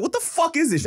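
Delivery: very high, loud exasperation; super annoyed. Retold or imitated. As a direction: re-enact an exasperated outburst. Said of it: "I was super annoyed".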